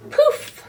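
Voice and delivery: very high, loud poof; magic poof. Acted. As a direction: burst it out like magic. Rabbit vanishes again.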